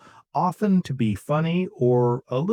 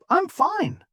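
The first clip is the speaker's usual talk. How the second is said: high, happy reply; upbeat, cheerful. Shown as a demonstration. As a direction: answer brightly with a much higher pitch. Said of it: "Upbeat, cheerful, rising slightly".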